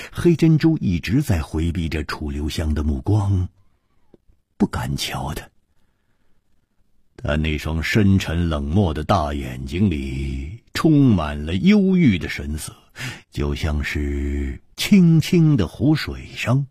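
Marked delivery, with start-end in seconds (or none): none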